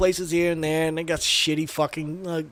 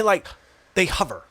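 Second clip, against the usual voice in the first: usual speaking voice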